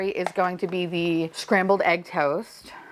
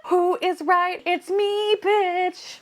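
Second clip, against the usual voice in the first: triumphant squeal; 13 semitones higher, slower than usual. Real, not acted. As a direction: crow about being right. Triumphant reveal, right guess.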